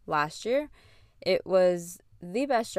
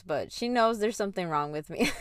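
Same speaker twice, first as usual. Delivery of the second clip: ordinary delivery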